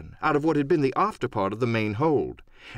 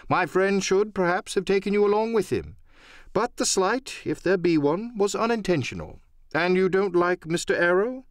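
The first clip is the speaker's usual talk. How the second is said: lightly voiced doctor, pitched higher; a hint of a polite, reasoning gentleman, raised voice; doctor soothing the captain, pitched up; 7 semitones higher than usual.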